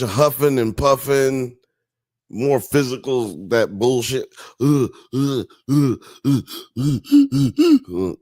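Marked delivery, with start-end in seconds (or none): 4.6-8.1 mock breathing. Shown as a demonstration. Streamer mocking yoga class with huffing breaths. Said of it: "crazy breathing like that shit".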